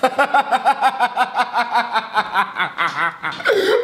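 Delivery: high, helpless laughter; a real fit of laughter; long laughing fit. Real, not acted. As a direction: crack up for a long stretch. Reacting to absurd video.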